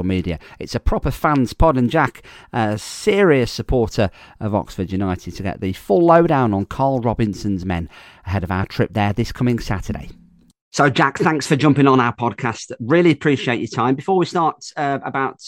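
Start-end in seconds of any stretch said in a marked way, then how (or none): none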